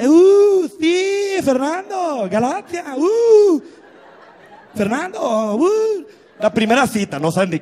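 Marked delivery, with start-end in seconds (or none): 0.0-3.6 high, drawn-out whoops and calls; party whooping. Acted. Dancing with Fernando at a party, high excited whoops. 4.7-6.0 party whooping. Acted. Acting out dancing at a party.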